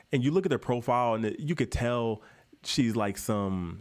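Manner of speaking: ordinary talk, nothing marked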